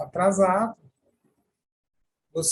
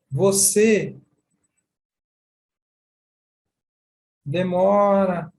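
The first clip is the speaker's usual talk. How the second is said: demora stretched long and slow, a bit louder; slow emphatic demora; 5 dB louder, much slower than usual. Shown as a demonstration. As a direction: drag out você demora to show a long wait. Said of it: "Ó a minha expressão". (você demora bem arrastado e enfático)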